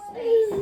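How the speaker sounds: sharp high gasp; crowd gasp. Retold or imitated. Imitating the courtroom crowd hearing not guilty, gasp. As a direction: gasp as the verdict lands. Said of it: "everybody just kinda gasps".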